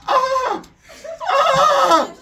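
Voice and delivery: very high, loud mock shriek; short screams of exaggerated shock; crowd scream. Storyteller as crowd horrified by the goddess, mock screams.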